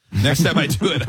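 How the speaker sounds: usual speaking voice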